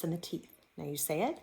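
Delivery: normal talking voice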